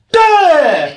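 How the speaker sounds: loud, high drawn-out "duh"; exasperated "duh". Acted. He acts out not knowing what to do, exasperated wail. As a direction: blurt a dopey wail in frustration. Acting out his bewildered reaction.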